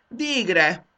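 high, loud cry of relief; exclaimed. Acted. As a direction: shout it out at last. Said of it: "kiáltotta Mondán".

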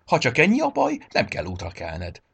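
brightly helpful, starting high and loud; lifted, cheery opening that settles down; cheerful reply. A narrator's reading. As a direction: answer gladly with the solution. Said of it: "felelte vidáman".

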